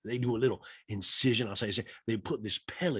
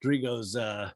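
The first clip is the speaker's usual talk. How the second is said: ordinary talk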